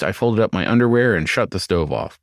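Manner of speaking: ordinary delivery, nothing marked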